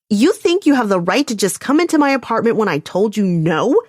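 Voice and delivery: fierce, rising outrage at the door; angry confrontation. A narrator's reading. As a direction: tear into him for barging in. Calliope confronts man at her door.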